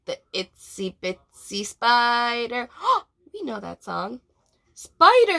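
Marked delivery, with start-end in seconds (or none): 4.7-5.4 high, loud excited shout; yell. A narrator's reading. Kids yelling their guess at Sparky's game. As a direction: blurt the answer out. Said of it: "the kids yelled".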